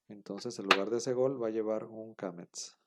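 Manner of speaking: usual speaking voice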